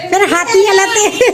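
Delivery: high and loud, through laughter; laughing. Real, not acted. Mother joking to viewers about the greedy kid, laughing loudly. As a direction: squeeze the words out while laughing.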